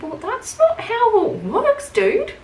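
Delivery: high-pitched, drawn-out pushback; incredulous retort. Retold or imitated. Worker answering a pushy customer, incredulous and high. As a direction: stretch it out high, mock disbelief.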